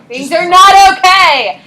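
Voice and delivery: loud, slow, high burst of frustration; teacher snapping at the class, loud and high; exasperated outburst. Real, not acted. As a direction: throw up your hands and shout it. Teacher exasperated, repeating loudly.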